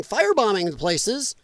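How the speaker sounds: normal talking voice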